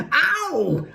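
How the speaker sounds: high, loud "ow" of pain; yelp of pain. A narrator's reading. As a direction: yell out as the cane lands. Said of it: "and yelled".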